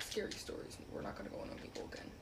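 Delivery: ordinary delivery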